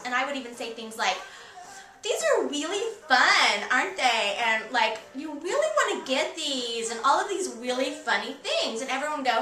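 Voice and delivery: playing an eighties valley girl. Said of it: "stop talking like a valley girl now".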